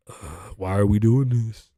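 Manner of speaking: slow, drawn-out exasperation; fed-up, dragging complaint; exasperated groan. Real, not acted. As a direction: groan it out, stretched and fairly full-voiced.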